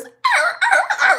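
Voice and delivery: high, loud yelps of a dog; imitated dog yelping. Owner mimics what was heard from the coop, loud high yelping. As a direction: yelp out "arr" in sharp repeated bursts.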